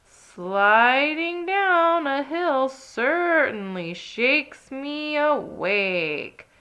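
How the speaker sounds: slow, loud, stretched-out scream. Acted. Ted screaming while sledding down the hill, slow and loud. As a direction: hold the words out as you fly downhill. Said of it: "Ted screamed all the way".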